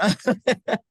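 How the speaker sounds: short, high, choked-up laugh; embarrassed laugh. Real, not acted. Apologizing to the group for getting emotional, laughs. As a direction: laugh off the tears. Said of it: "I'm sorry, I'm getting emotional".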